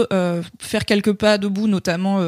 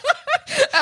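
higher, amused, on the edge of laughter; laughing through it; 5 semitones higher than usual. Real, not acted. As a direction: try it while giggling. Rit en improvisant des phrases. (en riant, voix plus aiguë)

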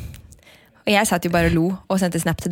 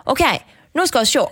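a hint of Sunnmøre dialect, slightly raised; crazy Sunnmøre photographer; 4 semitones higher than usual. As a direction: lightly imitate a fussy photographer from Sunnmøre. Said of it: "en gæren sunnmøring". (lett imitert sunnmørsdialekt)

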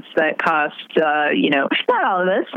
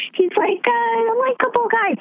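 much higher, slow, sarcastic lilt; mock likable-guy tone. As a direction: parody the fans' view. Mocking voter view, then restated plainly.

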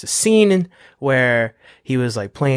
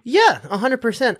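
usual speaking voice

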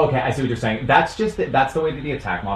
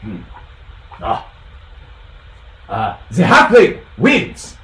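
judge's verdict; 3 semitones lower, 3 dB louder, slower than usual. Acted. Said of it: "And then he goes".

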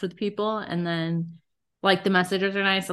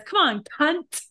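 imitating her friend; 8 semitones higher than usual. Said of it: "She goes like this".

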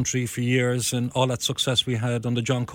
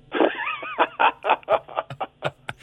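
laughing at the punchline. Real, not acted.

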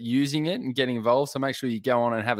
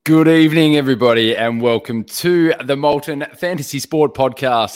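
booming welcome; 2 semitones higher, 10 dB louder than usual. Real, not acted. Big podcast opening welcome.

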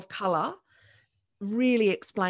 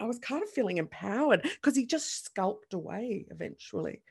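normal talking voice

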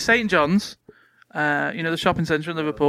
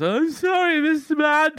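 tearful apology; 11 semitones higher, slower than usual. Retold or imitated. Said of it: "I took it back in tears".

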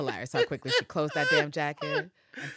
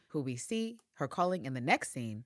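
normal talking voice

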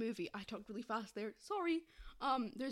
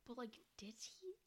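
whispering; 14 dB quieter than usual. Real, not acted. Whispered doubt.

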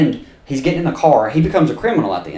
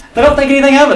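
laughing; 9 dB louder, much faster than usual. Real, not acted. Laughing while retelling.

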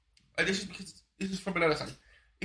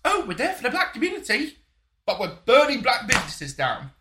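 mocking quote; 7 semitones higher, 7 dB louder than usual. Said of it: "I turned around and said".

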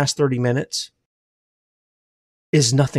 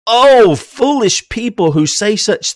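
passionate preaching; 9 semitones higher, 3 dB louder than usual. Real, not acted. Preaching at full force.

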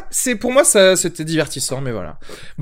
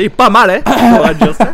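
put-on louder, higher Southern French accent, half laughing; heavier Southern accent; 6 semitones higher, 7 dB louder than usual. Teasing Arnaud about his Southern French accent, laughing. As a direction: ham up the Southern French twang. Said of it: "il aura un accent encore plus prononcé".

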